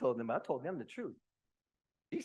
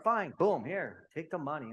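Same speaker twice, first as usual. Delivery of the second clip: loud, raised-pitch exasperation; exasperated giving in; 6 semitones higher, 8 dB louder, slower than usual. Retold or imitated. Husband retelling handing his wife the money, exasperated. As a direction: give in loudly, fed up. Retold giving in to her demands.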